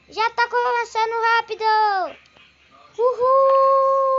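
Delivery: thrilled roller coaster cheer. Real, not acted. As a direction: cheer as the ride speeds up. Roller coaster starts fast.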